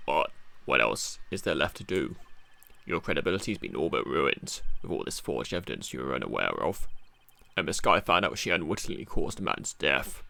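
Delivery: low, gruff, rough-spoken detective; Gumshoe voice. Acted. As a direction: growl it like a tough-guy cop. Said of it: "how does my, uh, Gumshoe voice sound".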